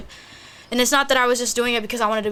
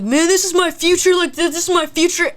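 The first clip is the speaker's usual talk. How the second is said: high, loud retold insistence; emphatic protest; 8 semitones higher, 5 dB louder than usual. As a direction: imitate the boyfriend pleading about what's at stake. Acting boyfriend's protest.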